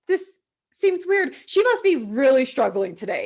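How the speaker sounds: mock concerned judging. Mock onlookers judging her crying.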